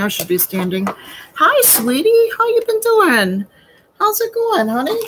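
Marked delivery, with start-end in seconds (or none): 1.3-5.0 high, loud sing-song greeting to a pet; pet talk. Real, not acted. Greeting a pet mid-bake in high pet talk. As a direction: coo at the pet in a much higher voice.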